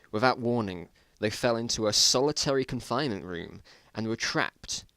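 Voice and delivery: ordinary delivery, nothing marked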